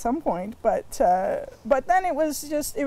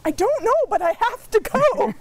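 eager answer; 10 semitones higher, 5 dB louder than usual. Retold or imitated. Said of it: "my parents would say".

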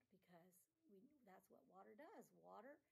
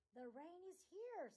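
raised, eager call; rhino calling out; 6 semitones higher, 7 dB louder than usual. Acted. Rhino tells the animals the rain has come, calling out loud. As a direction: announce it to the whole plain.

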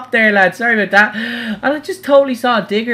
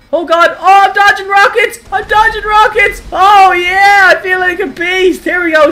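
high, loud, exhilarated yelling; thrilled shouting; 9 semitones higher, 5 dB louder than usual. Real, not acted. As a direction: whoop as you dodge incoming fire. Dodging rockets in the game.

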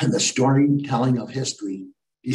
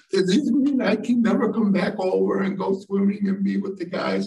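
put-on high, worried little-kid voice; six-year-old boy; 6 semitones higher than usual. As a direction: imitate a small child asking anxiously. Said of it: "then the littlest guy".

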